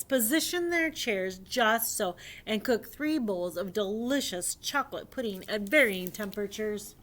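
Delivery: animated, emphatic read-aloud; lively storyteller lifting key words; storybook reading. Acted. Reading a dinosaur fairy tale to young friends, animated storybook reading.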